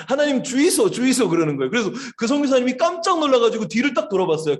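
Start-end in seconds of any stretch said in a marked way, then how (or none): 0.1-1.3 higher, imploring Gyeongsang dialect prayer. Retold or imitated. Missionary imitating prayer heard from behind, Gyeongsang dialect. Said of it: "경상도 사투리로 기도하는 소리가".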